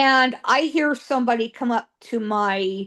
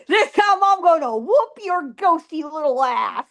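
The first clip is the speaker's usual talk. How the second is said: mock threat; 5 semitones higher than usual. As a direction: threaten a ghost in jest.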